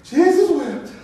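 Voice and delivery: high, loud mock-solemn aunt; aunt's terse grace. Aunt saying her short grace at dinner, high mock solemnity. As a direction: pitch it up as the aunt's little prayer. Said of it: "she said".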